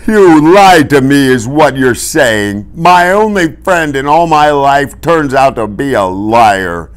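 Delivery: loud, slow wounded accusation; hurt, indignant high-pitched outburst; betrayed Fezzik. A narrator's reading. Fezzik, panting, feels betrayed by friend.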